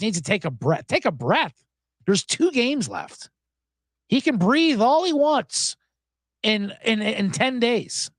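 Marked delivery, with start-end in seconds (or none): none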